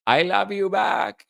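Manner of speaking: politician's fake Mexican accent; Kamala Harris Mexican accent. Host answering a fan as the candidate, mock Mexican accent. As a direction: put on a hammy Mexican accent. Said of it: "in my Kamala Harris Mexican accent".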